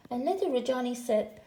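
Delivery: plain reading voice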